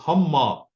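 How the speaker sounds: हम माँ, loud, with the m read twice; emphatic doubled m in हम माँ; doubled mem. Shown as a demonstration. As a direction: close हम on m and restart माँ on m. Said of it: "दो दफा हम उसे पढ़ेंगे".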